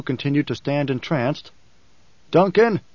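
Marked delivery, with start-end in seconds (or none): 2.3-2.8 sharp call to wake him. Acted. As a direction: call his name firmly to snap him out of it. Paul calls entranced Idaho.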